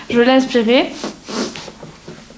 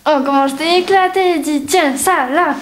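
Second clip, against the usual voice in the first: put-on gloating little girl; 4 semitones higher than usual. Kid gloating after win.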